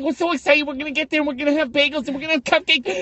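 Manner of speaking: high squeaky mock of the sisters; lightly squeaky sister parody; mock excited sisters. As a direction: imitate them gushing about treats. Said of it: "trying to do the Mike Tyson fucking voice".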